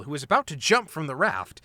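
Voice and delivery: ordinary delivery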